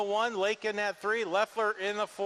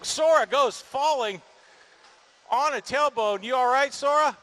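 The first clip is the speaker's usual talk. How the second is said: calling out; 3 semitones higher, 4 dB louder than usual. Real, not acted. Driver crashes, announcer calls out.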